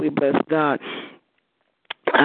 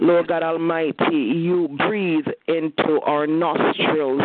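rising, impassioned prayer voice building toward a climax; fervent prayer; 7 semitones higher than usual. Real, not acted. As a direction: church prayer leader pouring out thanks.

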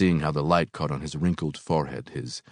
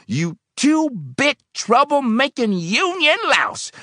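high, slow, loud tirade; enraged insult; 7 dB louder, slower than usual. A narrator's reading. Old man accusing helper of union ties, enraged. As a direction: drag out each insult at screaming pitch.

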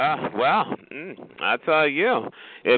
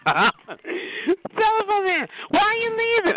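very high, drawn-out mock plea; teasing call-out; much slower than usual. Real, not acted. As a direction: call after them teasingly, high and stretched. Teasing the departing caller.

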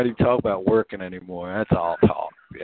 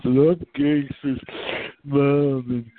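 put-on slow, slurred drunk mumble; a light mock of a drunk woman slurring; drunk slobbering mom; 4 semitones higher, much slower than usual. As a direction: imitate a sloppy drunk mother.

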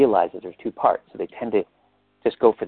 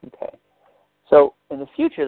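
usual speaking voice

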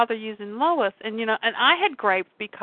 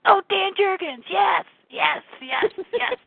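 excited recognition; 8 semitones higher than usual. Retold or imitated.

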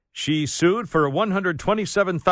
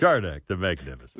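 low, fast grand introduction; low mock announcer voice; 11 semitones lower, much faster than usual. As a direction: boom it out like a showman. Repeats the announcer's intro in character.

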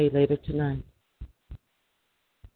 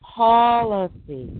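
drawn-out, slow pitch of a superpower; lightly voiced nation making an offer; slow mock America pitch; 3 semitones higher, much slower than usual. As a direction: stretch policy out slowly like a sales line. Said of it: "That's how we talk".

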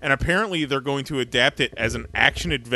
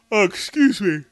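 higher, talking through a yawn; 6 semitones higher, 5 dB louder than usual. Real, not acted. News host apologizing mid-story, yawning. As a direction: let a real yawn stretch the words. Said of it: "My own news is making me yawn".

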